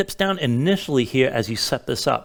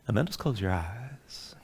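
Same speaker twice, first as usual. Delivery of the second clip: soft spa whisper; 4 semitones lower, 5 dB quieter than usual. Acted. Guides a relaxation breathing sequence.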